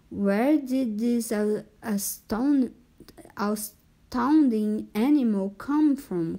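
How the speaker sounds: ordinary delivery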